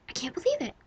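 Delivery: mock gasp of disbelief; gasp, then stunned disbelief; shocked gasp. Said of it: "it's not even an angry yell".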